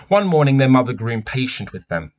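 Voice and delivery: animated picture book narration; story reading. A narrator's reading. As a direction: read it out like a storyteller. Reads the picture book aloud.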